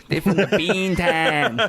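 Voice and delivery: put-on light Boston accent. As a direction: Bean Town in a Boston accent. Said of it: "I gotta get an accent in on the show".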